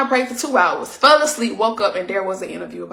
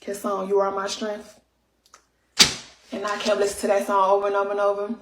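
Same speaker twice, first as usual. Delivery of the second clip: ordinary delivery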